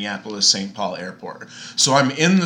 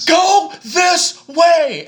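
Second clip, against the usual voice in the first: much higher, louder, slow barked order; imitated yell; 9 dB louder, much slower than usual. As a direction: bark it like a rude border guard. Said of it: "we're getting screamed at".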